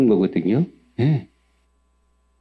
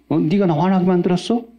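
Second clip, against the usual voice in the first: 1 mock angry driver; 7 semitones higher than usual. Acting an angry road-rage driver.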